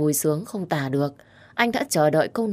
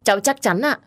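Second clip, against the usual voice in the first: put-on higher, certain young woman; firm affirmation; 6 semitones higher than usual. A narrator's reading. Girl assures her uncle she is sure, firm. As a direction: affirm it without hesitation. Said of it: "gật đầu khẳng định chắc nịch".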